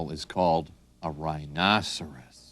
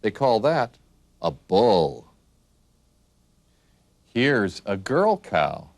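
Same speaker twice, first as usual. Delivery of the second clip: ordinary delivery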